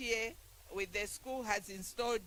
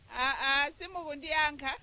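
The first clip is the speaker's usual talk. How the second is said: playful reproach; 5 semitones higher, 6 dB louder than usual. Real, not acted. Teases crowd for not answering.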